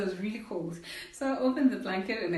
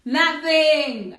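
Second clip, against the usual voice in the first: emphatic shout; 8 semitones higher, 11 dB louder than usual. Real, not acted. Didn't even go to toilet.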